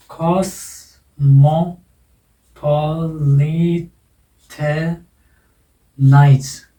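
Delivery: "cosmopolitanize" very slow, every syllable on its own. Shown as a demonstration. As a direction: chop "cosmopolitanize" into six drawn-out syllables with pauses. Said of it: "अब इसमें देखो कितने हैं".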